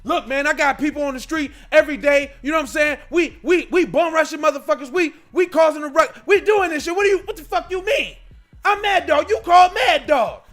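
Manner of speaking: loud, high gang boss shouting; boss yelling. Acted. The boss shouts down his sidekick, loud and high. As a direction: bark orders as the boss. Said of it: "This is the call to Mad Dog".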